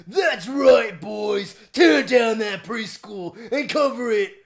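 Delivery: put-on booming military villain barking orders at his boys. Reading Ammo Baron's lines to co-player, a hammed-up villain shout. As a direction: command the troops like a mad general.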